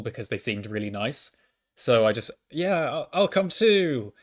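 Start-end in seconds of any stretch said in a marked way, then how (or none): 2.5-4.1 high, drawn-out retold eagerness; eager to join. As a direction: chime in keenly, hammed up. Retelling his eager agreement to join.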